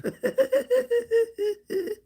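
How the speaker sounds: high, giggly laugh at a chat reply. Real, not acted. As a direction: crack up at the comment.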